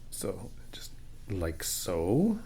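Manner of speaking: breathy slow whisper while concentrating. Real, not acted. Painting the door edge, demonstrating to partner, slow whisper. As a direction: hush it out slowly as the brush goes on. Showing brush technique, whispered.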